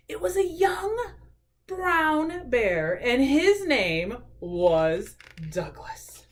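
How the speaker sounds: slow, drawn-out storytime reading; children's story narration. A narrator's reading. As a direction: read it to small children, stretching key words. Reads a picture book aloud.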